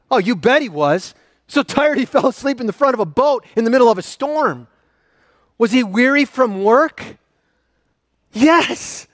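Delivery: usual speaking voice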